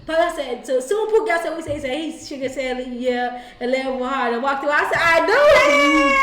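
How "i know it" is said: triumphant. Retold or imitated. Quoting triumphant reply.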